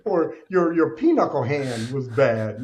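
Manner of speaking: ordinary delivery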